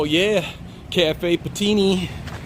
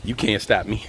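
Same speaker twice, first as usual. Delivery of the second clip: low, softer playful boast; low-pitched take; 5 semitones lower, 4 dB quieter than usual. Pretend. Vlogger playfully boasting on a morning walk, low-pitched. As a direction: say it down low in your range.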